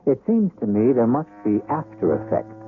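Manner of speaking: usual speaking voice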